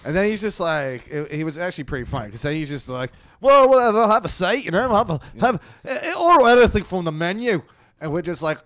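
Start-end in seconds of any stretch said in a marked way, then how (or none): 3.4-7.6 high, loud, stammering bluster; imitated blustering headliner. Headliner telling the comics to order food, mock blustering offer. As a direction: wave them off loudly in a put-on voice.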